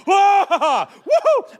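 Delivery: high, slow, loud hammed-up whoa woohoo with a laugh; mock frantic whoop. Wrecked his son's birthday gift, hammed-up frantic whoop. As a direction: play up losing it over the wrecked hoop. Pacing, losing it.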